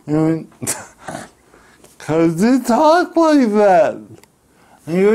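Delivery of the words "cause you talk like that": ordinary talk, nothing marked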